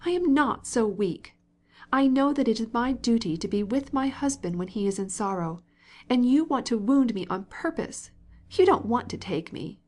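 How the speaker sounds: wife accusing her husband of wounding her, tearful and angry; tearful anger. A narrator's reading. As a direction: fight back through tears. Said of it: "choking with tears of vexation and anger".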